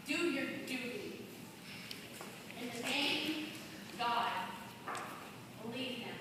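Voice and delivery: soft, hushed final plea; quiet plea. Acted. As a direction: drop to a hush for the last appeal. Closing plea to the jury.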